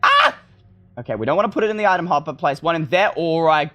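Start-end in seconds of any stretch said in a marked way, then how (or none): 0.0-0.4 startled yelp. Real, not acted. Startled in game.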